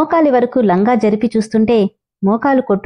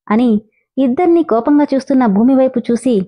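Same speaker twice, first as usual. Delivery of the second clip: plain reading voice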